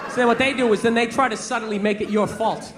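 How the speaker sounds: normal talking voice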